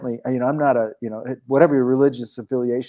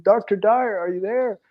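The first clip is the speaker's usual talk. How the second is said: calling out; 9 semitones higher than usual. Retold or imitated. Calling out for Dr Dyer.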